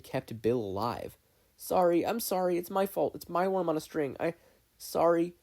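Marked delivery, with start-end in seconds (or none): 1.6-5.3 high, loud, frantic sorry; panicked apology. Acted. Dipper apologizing to Steven, high and panicked. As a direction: tumble out guilty apologies.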